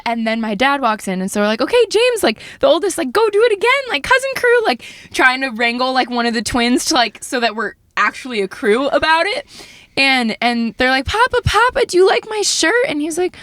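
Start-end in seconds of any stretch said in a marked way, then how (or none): 1.6-4.7 high, urging aunt voice; mock bubbly prompting; retold coaxing. Coaxing the child to do it again. 11.1-12.9 put-on high-pitched child voice; playful little-kid plea; imitated eager kids. Voicing kids pestering grandpa.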